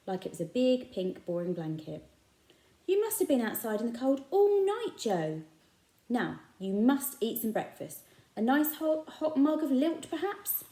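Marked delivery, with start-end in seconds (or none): 2.9-10.6 high, fussing shopkeeper voice; kindly shopkeeper. A narrator's reading. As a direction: coax him like a kind uncle.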